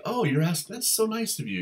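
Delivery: the eager reply he didn't give, high and gushing; mock delighted gush. Asked out for a drink, the ideal reply, gushing high. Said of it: "he has no, like".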